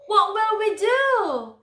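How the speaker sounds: high, loud, slow frightened question; frightened plea. Acted. Gretel panicking in the forest. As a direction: plead it like a scared lost child.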